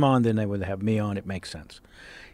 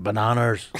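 Liam Neeson; 3 semitones lower, 3 dB quieter than usual. Retold or imitated. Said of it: "picture him at an ihop".

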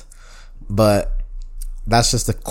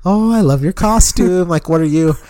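imitated chatty old lady; 7 semitones higher, 4 dB louder than usual. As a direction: mimic a lady fussing over trick-or-treaters. Voices old lady chatting to trick-or-treaters.

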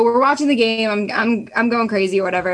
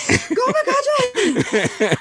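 high, drawn-out fan cheer; screaming cheer; much slower than usual. Retold or imitated. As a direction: reenact screaming for your player, stretched out. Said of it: "I start standing up screaming".

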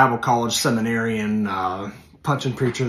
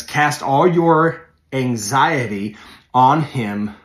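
each word of "Cast all your anxiety on him" drawn out slowly and firmly; slow, weighty verse reading; 4 dB louder, much slower than usual. Shown as a demonstration. Preacher slowly reading the verse to his listeners, drawn out. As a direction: read it slow and deliberate, like scripture. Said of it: "First Peter five seven says".

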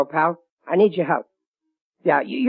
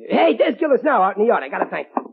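normal talking voice

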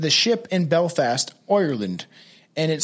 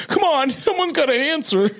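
higher, laughing mock panic; laughing high desperate plea; 10 semitones higher than usual. Mock radio operator begging for an answer, laughing. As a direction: beg through a laugh. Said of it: "He's like".

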